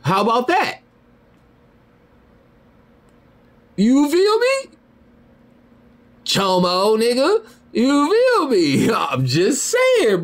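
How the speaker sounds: high falsetto, drawn-out words; gloating singsong squeal with a little laugh; mocking taunt. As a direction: tease slowly in a squeaky rising voice. Taunting mother after counter-accusation.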